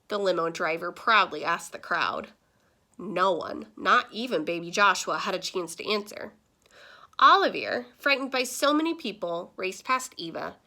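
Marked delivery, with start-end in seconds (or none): none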